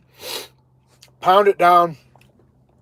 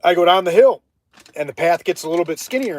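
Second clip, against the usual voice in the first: ordinary talk, nothing marked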